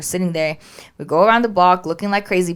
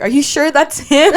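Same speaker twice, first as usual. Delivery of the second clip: higher, louder doubting question; mock doubtful husband; 8 semitones higher, 7 dB louder than usual. Wife mimics husband doubting the dog is theirs, lightly voiced. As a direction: play the unsure husband, half laughing.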